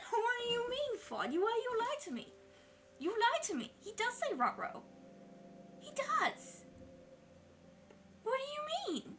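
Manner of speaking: high, laughing, half-crying protest; squeaky betrayed laughter; laughing disbelief. Real, not acted. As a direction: whine that you were lied to. Accused by chat of being wrong.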